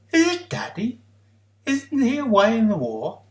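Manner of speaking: put-on high, eager child's question; breathless hope of a small child; hopeful child. A narrator's reading. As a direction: wonder aloud, hoping it's Daddy. Child hopes to see father.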